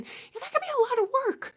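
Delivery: "that could be a lot of work" high, fast and squeaky; squeaky sympathy. Acted. Sympathizing with Cancers, squeaky high and fast.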